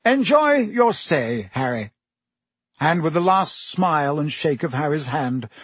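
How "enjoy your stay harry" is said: brisk Minister voice. A narrator's reading. Fudge voiced by narrator.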